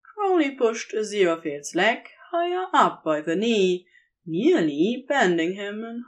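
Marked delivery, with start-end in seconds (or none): none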